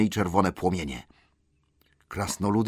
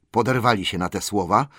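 ordinary delivery, nothing marked

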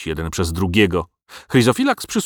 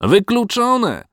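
high, slow outraged cry; crowd shouting; 13 semitones higher, 4 dB louder, much slower than usual. A narrator's reading. Villagers rejecting the dragon's offer, a high, drawn-out shout. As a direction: drag out the refusal loud and high. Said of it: "zawołali ludzie".

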